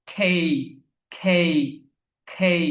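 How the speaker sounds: খেই with a puffed k and a glide, "khey"; British pronunciation. Shown as a demonstration. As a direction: aspirate the k in খেই, gliding into i. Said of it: "British প্রনাউন্সিয়েশনে".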